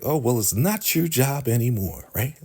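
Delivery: imitating a petty comeback; petty jab. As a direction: rub it in with a put-on lilt. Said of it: "of course, being petty".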